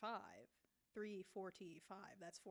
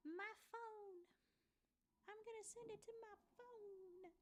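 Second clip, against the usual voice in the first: much higher, softer, to herself; high sing-song; 12 semitones higher, 6 dB quieter than usual. Real, not acted. Streamer murmuring to herself while sending to her phone. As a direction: lift it high and soft.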